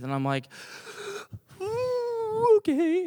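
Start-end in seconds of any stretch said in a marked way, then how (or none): 1.6-3.0 high, sobbing intake and squeaky agreement; mock tearful gasp. Crying boy obeying his coach, high mock-tearful gasp. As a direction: gasp and squeak it through pretend tears.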